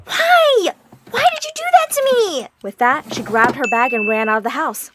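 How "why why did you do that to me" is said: very high, loud crying plea; girl bursting into tears at her sister; tearful. Acted. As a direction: cry out the betrayal. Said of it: "burst into tears and said".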